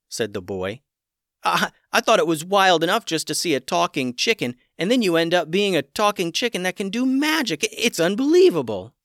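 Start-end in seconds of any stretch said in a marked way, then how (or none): 1.4-8.9 put-on boy thrilled by the magic; amazed boy. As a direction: marvel at the magic.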